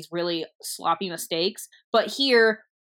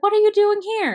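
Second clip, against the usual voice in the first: high, louder acted surprise; surprised question; 7 dB louder than usual. Brother asking the messenger why he is here, surprised. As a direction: ask it with voice leaping up.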